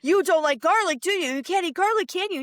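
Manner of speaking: high, sing-song gotcha taunt; taunting boys. Retold or imitated. Frog Brothers catching Max out, high sing-song taunt. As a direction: needle him like you caught him out.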